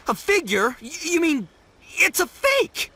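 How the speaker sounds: rising, stammering shock; dismayed disbelief. Acted. Learning his present is only a figurine, dismayed disbelief.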